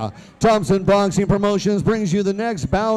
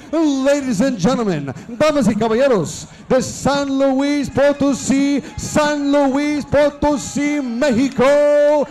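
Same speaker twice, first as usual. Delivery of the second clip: lucha libre announcer; 3 semitones higher than usual. Acted. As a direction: stretch the names like a ring announcer. Ring announcer introducing fighter.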